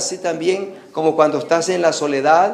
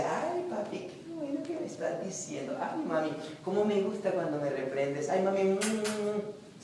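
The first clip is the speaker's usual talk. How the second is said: mocking child; 3 semitones higher, 15 dB quieter than usual. Preacher mocking a scolded child who thanks his father. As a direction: mimic a child sweetly thanking his parents. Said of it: "su hijo se ríe y le dice".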